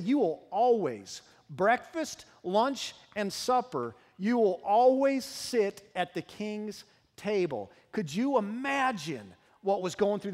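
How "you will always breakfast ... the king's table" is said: slow, each meal spelled out, always stressed; emphatic king's promise. Acted. Pastor voicing King David's promise, slow and emphatic. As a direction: pronounce it slowly like a royal decree. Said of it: "He said".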